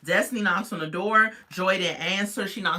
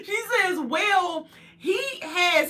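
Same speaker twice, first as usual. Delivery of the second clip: laughing; 12 semitones higher, slower than usual. Real, not acted. Laughing through the retelling.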